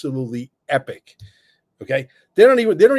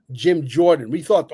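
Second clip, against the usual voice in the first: ordinary talk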